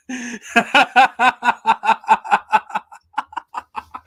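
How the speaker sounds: long, loud, high cackling laugh. Real, not acted. Fan cracking up over a beloved bad movie, long high laugh. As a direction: crack up helplessly and keep going.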